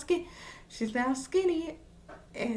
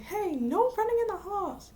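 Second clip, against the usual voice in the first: high, singsong calling out; mock hallway reprimand; 9 semitones higher than usual. Boy calls to the girl running in halls, mock hallway reprimand. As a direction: imitate calling after a runner, pitched way up. Retold hallway call to a runner.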